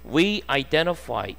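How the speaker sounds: slow read-aloud. Shown as a demonstration. Teacher reads phrase.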